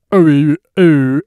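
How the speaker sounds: loud drawn-out oh oh; mimicking the professor. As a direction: imitate his odd sounds. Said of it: "then he'll be like".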